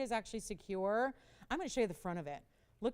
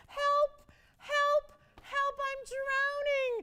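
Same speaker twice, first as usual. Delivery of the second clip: high, loud hammed-up shouts for help; mock cry for help; 7 dB louder, much slower than usual. Shopping host begging viewers for bids, mock-drowning cry for help. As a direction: yell like you're sinking, all in fun. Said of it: "I'm crying out for help".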